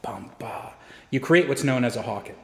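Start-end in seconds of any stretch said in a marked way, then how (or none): 0.0-0.7 the other side answers in a whisper; whispered echo. Shown as a demonstration. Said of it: "and they answered back and forth".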